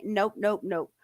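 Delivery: ordinary talk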